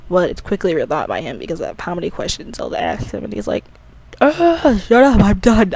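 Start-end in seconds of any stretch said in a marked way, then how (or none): 4.2-5.7 loud, higher fed-up groan into words; mock exasperation, pitched up and loud; exasperated outburst. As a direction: act out someone who's had enough of questions. Link fed up with questions, retold.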